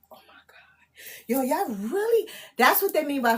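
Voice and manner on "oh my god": stunned whisper. Real, not acted. Streamer reacting in disbelief to a comment, whispered. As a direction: breathe it out, no voice.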